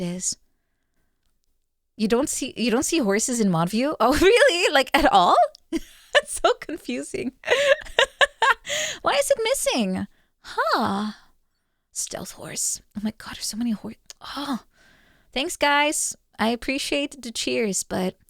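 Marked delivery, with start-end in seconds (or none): 4.0-9.4 real giggling disbelief; laughing. Real, not acted. Laughing at mod view news. 11.9-14.6 quick hushed whisper. Real, not acted. Spotting hidden horses, hushed.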